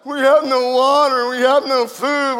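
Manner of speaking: loud, drawn-out complaint; mock grumbling. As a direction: grumble like an aggrieved crowd. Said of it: "we're grumbling and complaining".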